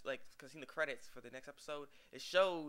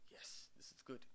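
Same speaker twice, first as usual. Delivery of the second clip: whispered; 2 semitones lower, 13 dB quieter than usual. Retold or imitated. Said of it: "Sakuru's like".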